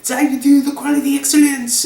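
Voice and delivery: high-pitched mock French chef voice. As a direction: announce it in a put-on French accent. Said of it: "this whole thing as a French chef".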